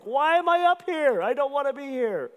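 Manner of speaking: high, loud comic whine; embarrassed mock wail. Embarrassed at being up front, high mock wail. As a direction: wail it in exaggerated tearful embarrassment. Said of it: "I'm embarrassed".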